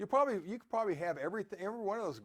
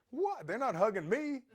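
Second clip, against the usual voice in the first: mock indignant surprise; 4 semitones higher than usual. Mock jealous friend's reaction.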